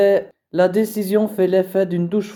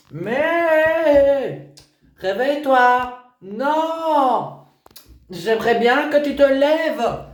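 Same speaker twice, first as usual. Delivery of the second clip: put-on high, louder whiny teenage refusal; whining teen; 8 semitones higher, 3 dB louder, much slower than usual. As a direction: whine and refuse like a sulky teen. Daughter whines refusing to get up.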